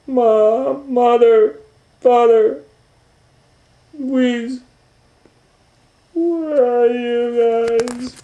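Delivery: very high, loud drawn-out calls; calling out lost. Acted. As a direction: wail for her family, slowly. Lost girl calling family.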